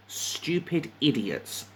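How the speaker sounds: ordinary delivery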